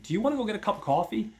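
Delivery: high, fast eager invite. Retold or imitated. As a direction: rush the question out, high and quick. Guy asking woman out.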